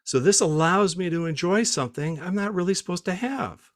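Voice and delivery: high-pitched mock wife voice; imitating wife. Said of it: "she says".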